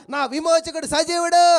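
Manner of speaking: loud exultant cry of faith; triumphant declaration. Acted. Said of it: "నా యోబు ఏమంటున్నాడు తెలుసా?".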